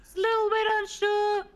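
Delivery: a high, squeaky put-on voice. Mocking a pal in a squeaky high voice. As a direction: pitch it way up for a silly voice.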